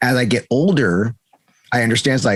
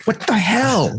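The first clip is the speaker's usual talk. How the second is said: incredulous; 11 semitones higher, slower than usual. Real, not acted. Reacting to own impression.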